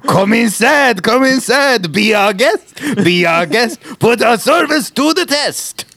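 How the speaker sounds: high-pitched, over-the-top French host; mock French showman, stretching out the last words; hammed-up French accent. Riffing on the French boss with Be Our Guest, hammed-up French accent. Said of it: "the French sound like that".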